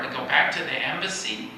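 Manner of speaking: normal talking voice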